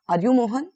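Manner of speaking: "Mohan" lifted as a question; rising question tone. Shown as a demonstration. Teacher shows students a question, rising on "Mohan". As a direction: ask it with pitch rising on "Mohan". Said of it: "वो एक rising tone होती है".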